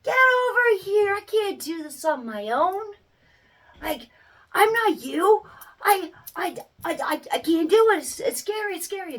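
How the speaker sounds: put-on high, pleading child voice; toddler's frightened voice. Toddler begging mom not to walk away, high and pleading. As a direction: beg like a scared little kid. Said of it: "They're like".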